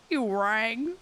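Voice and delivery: goofy cartoon voice, laughing; a silly Goofy try that cracks up; half-hearted Goofy impression; mock Goofy voice. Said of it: "Make him sound like Goofy".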